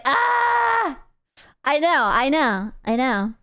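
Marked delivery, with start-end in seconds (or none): 0.0-1.0 high exasperated "ah"; short put-on scream, pitched way up; mock scream. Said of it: "And I would be like".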